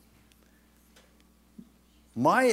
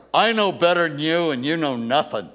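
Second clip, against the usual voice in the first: louder, scornful know-it-all jab; mock arrogant shout; 3 semitones higher, 9 dB louder than usual. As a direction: bark it like you're in their face. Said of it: "made them feel like".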